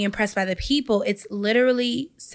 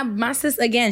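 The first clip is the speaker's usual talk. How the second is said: normal talking voice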